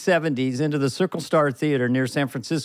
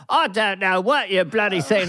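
Michael Caine voice; 3 semitones higher than usual. Pretend. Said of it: "practicing ... my voices".

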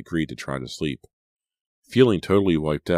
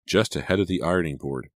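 ordinary delivery

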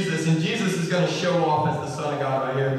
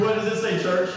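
ordinary talk, nothing marked

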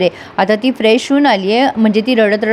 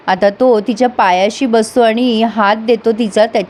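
ordinary talk, nothing marked

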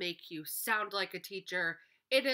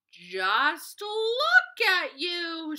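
much higher and louder, slow scolding cry; scolding scream; 11 semitones higher, 10 dB louder, much slower than usual. Acted. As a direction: shout at the muddy animals like a fed-up mother. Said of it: "she screamed".

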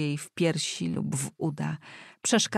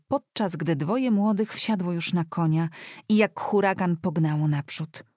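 plain reading voice

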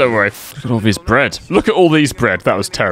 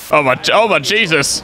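ordinary talk

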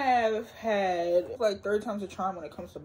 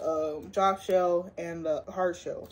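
usual speaking voice

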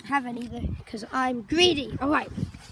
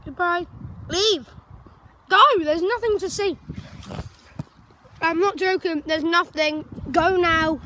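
louder, pushing viewers to leave; mock shooing away; 2 semitones higher, 3 dB louder than usual. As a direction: shoo the audience off firmly.

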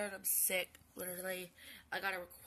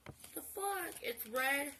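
higher-pitched exasperated retort; protest, baffled and raised; indignant disbelief; 5 semitones higher than usual. Retold or imitated. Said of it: "And I'm like".

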